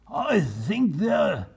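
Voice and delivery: high, slow captain's voice; lightly voiced captain. Captain Bill telling his men where the turkeys hid. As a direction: point and muse slowly. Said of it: "said Captain Bill".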